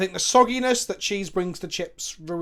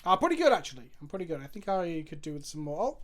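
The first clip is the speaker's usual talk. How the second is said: normal talking voice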